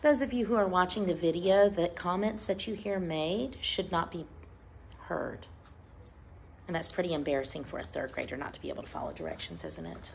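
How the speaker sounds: low, hushed teacher's scolding; quiet reprimand. Real, not acted. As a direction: drop your voice low and soft to shame the pupil. Teacher scolds pupils mid-reading.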